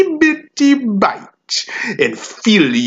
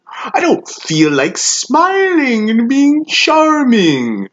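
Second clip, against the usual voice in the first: high, drawn-out sneer; theatrical, slow, unhinged mockery; mocking venom; 10 semitones higher, 3 dB louder, slower than usual. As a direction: swoop up high in scornful exaggeration. Savage speaker mocks being charming.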